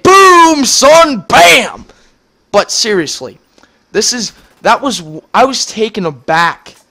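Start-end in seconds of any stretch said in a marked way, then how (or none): 0.0-1.8 loud, high gloating taunt; hammed-up victory shout from the teacher; mock gloating shout. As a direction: crow it like a teacher showing off. Teacher voiced gloating in retold story.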